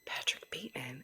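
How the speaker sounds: hushed eerie whisper. Acted. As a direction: breathe the name creepily. Whispers the movie character name.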